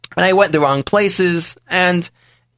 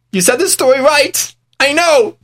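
high, louder, eager voice; excited reveal; 11 semitones higher, 6 dB louder than usual. Retold or imitated. Rich man excitedly telling the storyteller, raised pitch. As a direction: burst out with the secret.

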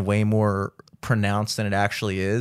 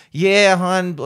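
imitated Baltimore local drawing out the vowels, higher; Baltimore accent; 6 semitones higher, 4 dB louder than usual. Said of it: "you don't hear the Baltimore accent".

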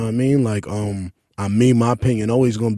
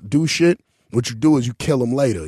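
ordinary talk, nothing marked

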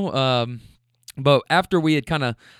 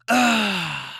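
higher, drawn-out effort groan; straining grunt; 5 semitones higher than usual. Retold or imitated. Heavy man reaching for car door, imitated straining grunt.

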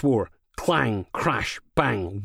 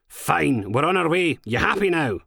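sarcastic; 7 semitones higher, 3 dB louder than usual. A narrator's reading. Steele gives in sarcastically.

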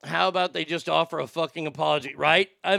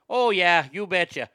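put-on light Wisconsin accent, drawn vowels; 3 dB louder than usual. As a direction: do a touch of Sheboygan, Wisconsin. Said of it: "not quite Sheboygan in Wisconsin".

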